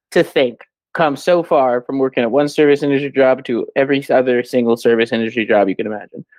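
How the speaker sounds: usual speaking voice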